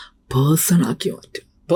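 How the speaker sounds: slow whispered definition of person ആക്കി മാറ്റുക; conspiratorial classroom whisper. Shown as a demonstration. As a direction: lean in and whisper the key phrase. Teacher stresses a key definition.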